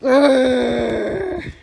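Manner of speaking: low, loud growl; rhino growl. Acted. The rhinoceros growling at Fluffy, loud and low. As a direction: growl it like a big beast. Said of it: "said the rhinoceros".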